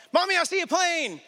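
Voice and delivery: put-on high little-boy voice; excited toddler calling out; child voice. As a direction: pipe it up like a small boy. Son voiced in retold story.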